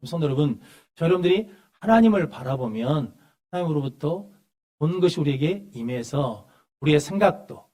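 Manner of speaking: normal talking voice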